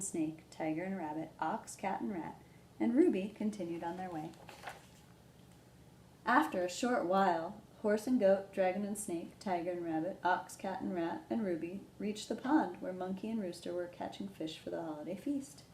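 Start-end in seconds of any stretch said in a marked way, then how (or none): none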